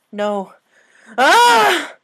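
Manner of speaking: loud, high terrified cry; nightmare scream. Acted. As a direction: jolt awake screaming. Waking from a nightmare.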